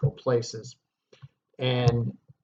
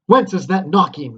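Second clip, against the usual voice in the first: loud, higher alarmed question; startled Macbeth; 6 semitones higher, 11 dB louder than usual. Acted. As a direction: jump at the sound of knocking. Dramatic reading of Macbeth.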